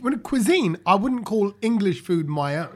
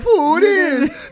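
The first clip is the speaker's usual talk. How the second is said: imitating a West Indian accent; mock Caribbean accent; 8 semitones higher than usual. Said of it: "Saj went a bit, I'm like, West Indian".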